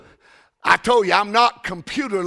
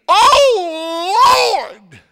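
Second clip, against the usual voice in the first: high, loud mock wail of Oh, Lord; hollering woman; 11 semitones higher, 6 dB louder than usual. Preacher imitating the crying secretary at full volume. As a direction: ham up the secretary's cry. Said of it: "she hollered".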